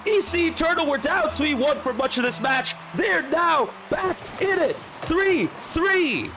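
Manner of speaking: high, fast-rising hype call of a late goal; live play-by-play. Acted. Caster calling the final seconds to the stream, hyped. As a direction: ride the action and build to a roar on the winner.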